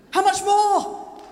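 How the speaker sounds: loud, slow and forceful; rousing shout. Shown as a demonstration. Preacher modelling the refrain for congregation, rousing shout. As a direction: belt it out to rouse the room. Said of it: "you're gonna say it with".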